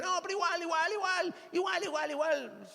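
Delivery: high mocking whine of igual, igual; mocking a stubborn excuse. Preacher mimics a churchgoer brushing off problems in a high mocking whine. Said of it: "cuando alguien me dice".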